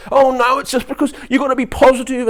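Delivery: very high, loud parody voice; hammed-up cheery nagging; mock positivity. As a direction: gush it like an overbearing optimist. Mimicking toxic positivity.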